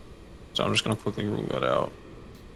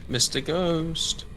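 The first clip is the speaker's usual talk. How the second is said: very slow, higher calling out; slow drawn-out call; 6 semitones higher, much slower than usual. Acted. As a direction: stretch out the name like a summons.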